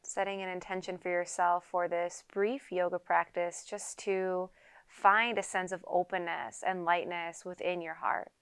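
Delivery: unhurried yoga teacher leading a quiet meditation; guided meditation. Acted. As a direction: calmly guide them into stillness, leaving space to breathe. Leads a seated guided meditation.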